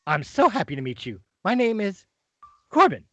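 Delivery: put-on an old wizard greeting the party at the door; Corbin, eager wizard. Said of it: "And he goes".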